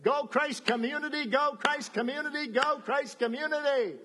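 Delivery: God cheering Mike and the church from the sidelines. Acted. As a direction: cheer them on like a coach. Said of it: "He said".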